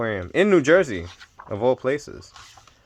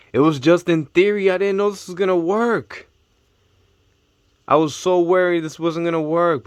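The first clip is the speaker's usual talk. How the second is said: thrilled; 7 semitones higher, 4 dB louder than usual. Real, not acted. Thrilled his theory worked.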